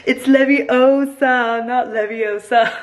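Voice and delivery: high, slow, loud mock Hermione correction; Hermione Leviosa quote. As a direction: drag out the correction like a know-it-all schoolgirl. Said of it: "and I wrote".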